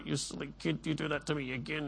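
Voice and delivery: a hint of a cross parent muttering; scolding parent. Preacher voices a scolding parent, hushed mock scold. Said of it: "Some parents would say".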